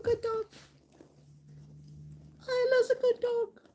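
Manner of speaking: high-pitched doggy talk; pet-praising voice. Real, not acted. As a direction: coo high praise to a beloved dog. Praising her dog in pet talk.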